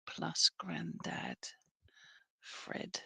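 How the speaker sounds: slow unvoiced whisper. Real, not acted. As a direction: breathe the name out slowly. Whispers the name.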